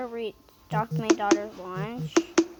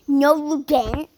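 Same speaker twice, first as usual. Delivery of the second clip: louder, higher mock yell; retold yelling; 4 semitones higher, 8 dB louder than usual. Others scolding the parent over lunch, retold yell. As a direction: imitate being yelled at, loud and indignant. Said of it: "then they started yelling at me".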